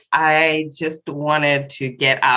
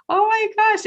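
much higher, thrilled burst of delight; delighted gasp. Real, not acted. Seeing the Falls on TV, thrilled much higher exclamation. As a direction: let it leap up with real joy.

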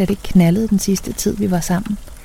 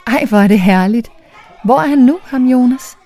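higher, eager and upbeat; delighted, pitched-up enthusiasm; beaming; 5 semitones higher, 4 dB louder than usual. A narrator's reading. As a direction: ask with a broad grin in the voice. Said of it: "Jeg smiler over hele tiøren".

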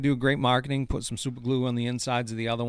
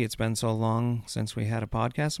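normal talking voice

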